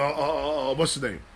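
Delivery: normal talking voice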